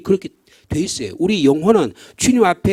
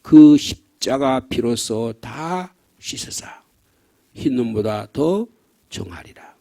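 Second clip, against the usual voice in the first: very slow, dropping to a hush at the end; slow fading hymn recitation; 4 semitones lower, much slower than usual. Shown as a demonstration. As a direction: let the verse die away into softness. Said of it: "사백십삼 장의 고간에는".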